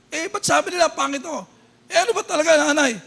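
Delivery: put-on high, quick child's pleading; child pressing mother for the truth; whiny child. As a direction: pester mom like a hurt kid. Child voiced in story.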